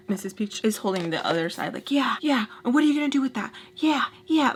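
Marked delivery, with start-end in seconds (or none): 1.9-4.5 high-pitched goading, retold; mock mad taunting. Storyteller as Missus Peach holding the papers away, high mock-angry taunt. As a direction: taunt in a raised, mimicking pitch. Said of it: "like, so mad".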